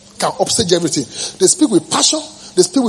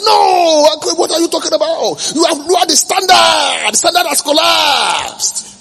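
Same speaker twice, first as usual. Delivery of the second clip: mock scolding critic; 7 semitones higher, slower than usual. Critics scolding about standards.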